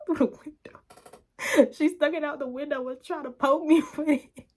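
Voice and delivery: high voice breaking into laughter; laughing while talking. Real, not acted. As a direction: struggle to tell it through laughs. Laughs while telling umbrella attack.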